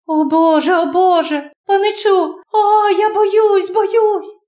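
cling to him in terror; high, shaking cry of fear; terrified girl. Acted. Terrified girl clutches narrator.

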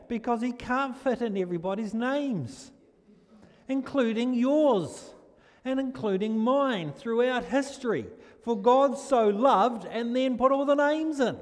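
slow, high, loud proclaiming; preacher's soaring emphasis on the Gospel; fervent preaching. Acted. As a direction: lift the verse up to the congregation. Preaching with fervour.

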